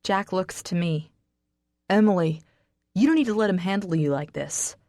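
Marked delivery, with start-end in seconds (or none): 1.9-4.8 loud call then pleading; raised, insistent; urgent appeal. Acted. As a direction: plead with her over the fight. Jack appealing to her loudly.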